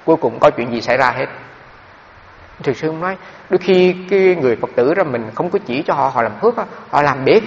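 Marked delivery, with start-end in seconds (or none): none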